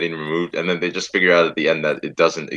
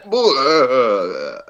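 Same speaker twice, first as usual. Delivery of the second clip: higher, drawn-out fake retch; mock throwing up; 6 semitones higher, 3 dB louder than usual. Class reacting to the word Zed, mock retch. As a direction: pretend to vomit mid-joke. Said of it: "programmed everyone to throw up at Zed".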